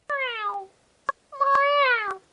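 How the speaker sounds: high plaintive meow; cat crying meow. Acted. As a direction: cry meow like a hungry cat. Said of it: "cried Simpkin".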